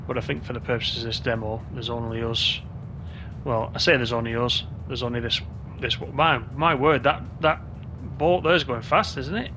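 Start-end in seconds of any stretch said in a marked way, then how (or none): none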